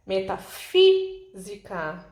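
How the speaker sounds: slow Metafísica down and hold a punched FÍ; stress on antepenultimate syllable. Shown as a demonstration. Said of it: "o fi é o mais forte". (Metafísica devagar, com o FÍ bem forte e alongado)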